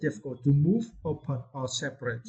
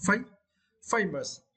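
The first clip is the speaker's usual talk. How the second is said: "fam-" set apart, then "famous" stressed at the front; stress on first syllable; 6 semitones higher, slower than usual. Shown as a demonstration. Teacher modelling the next word, first syllable of "famous" stressed. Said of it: "we need to stress the first syllable".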